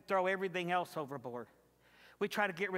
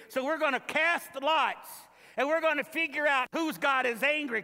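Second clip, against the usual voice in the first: high and loud, urgent crew; loud preaching in the sailors' voice; 6 semitones higher, 6 dB louder than usual. Acted.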